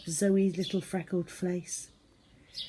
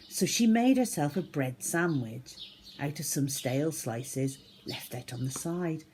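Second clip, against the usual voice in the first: ordinary delivery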